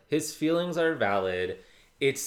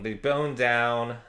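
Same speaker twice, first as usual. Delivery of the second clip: ordinary talk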